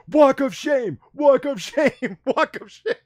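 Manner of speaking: high, loud heckling shout; mimicking a friend's drawn-out taunting yell; imitated yell. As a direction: holler it mockingly at passers-by, breaking into a laugh. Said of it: "he would actually point at them and yell".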